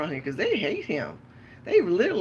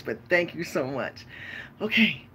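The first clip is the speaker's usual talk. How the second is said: ordinary talk, nothing marked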